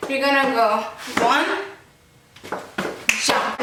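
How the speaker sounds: ordinary talk, nothing marked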